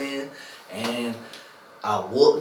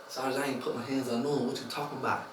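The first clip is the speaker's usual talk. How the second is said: soft, low retold protest; hushed denial; 3 semitones lower, 11 dB quieter, faster than usual. Inmate answering the whispering sergeant, hushed denial. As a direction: answer under your voice, keeping it down.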